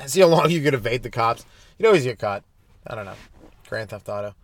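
normal talking voice

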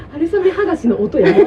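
bursting out laughing mid-sentence; can't stop laughing at the sound. Real, not acted. (笑いながら)

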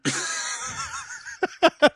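long, high, breathless laugh; big laugh. Real, not acted. Host joking about covid as a song, cracking up. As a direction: crack up at your own joke.

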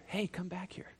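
put-on soft, hushed call to a child; quiet call. Shown as a demonstration. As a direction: say it as if barely calling the child back. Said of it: "you're not gonna whisper".

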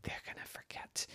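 soft whispered inner voice; whispered thought. A narrator's reading. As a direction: whisper the fear. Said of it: "she thought".

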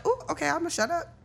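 high, quick mock-meek voice; sheepish backing down. Woman giving in to his retort, playfully meek. Acting her own backing down.

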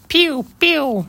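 high pew pew blaster noise; Star Wars blaster. Pretend. Playing with a blaster sound on a podcast, high pew pew. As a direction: zap it like a toy laser gun. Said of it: "me doing my Star Wars impression".

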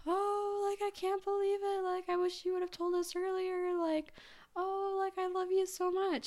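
very high mock-weepy voice; imitating tearful mom. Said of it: "crying, and she's like".

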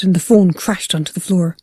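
ordinary delivery, nothing marked

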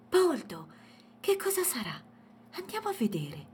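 put-on high, wondering child's voice; curious little girl. A narrator's reading. Matilde calls Poldo to the lights at the window, curious.

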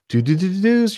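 high, loud rising "doo-doo-doo" imitation; donation alert jingle. Streamer imitating the alert that pops up on screen, mock jingle. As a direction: sing out a mock notification chime.

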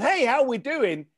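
ordinary talk

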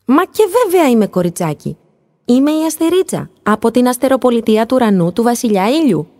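put-on high, proud little-girl voice. A narrator's reading. Little star proudly tells the dolphin who she is. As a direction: announce yourself proudly, like a small child. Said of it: "αποκρίθηκε με καμάρι".

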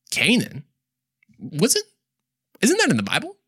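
high astonished question; surprised. Real, not acted. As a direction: sound amazed it's in the Bible. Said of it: "mock disbelief at discovery".

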